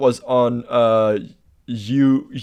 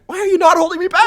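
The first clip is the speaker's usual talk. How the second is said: high, fast, louder comic protest; mock indignant outburst; 5 dB louder, faster than usual. Acting the unrestrained lad, mock indignation. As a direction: play the lad outraged nobody restrains him.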